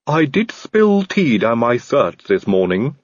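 slow, polite servant voice with a different timbre; a courteous, slightly sinister butler; Igor the servant. A narrator's reading.

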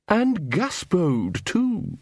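high, drawn-out poodle sneer; villain poodle. A narrator's reading. Poodle leader spotting a second intruder, menacing. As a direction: draw it out slowly, climbing high. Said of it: "said the poodle".

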